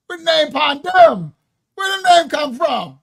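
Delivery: loud, high Jamaican patois try; mock Jamaican patois. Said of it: "in the Jamaican patois".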